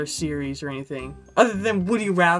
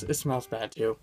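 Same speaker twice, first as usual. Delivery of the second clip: usual speaking voice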